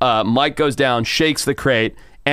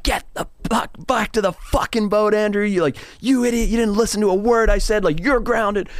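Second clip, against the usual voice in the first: much higher, retold furious yell; 11 semitones higher than usual. Producer yelling at Andrew to get back to the boat, furious retold shout. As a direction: reenact ordering the idiot back to the boat. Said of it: "Like, I was furious".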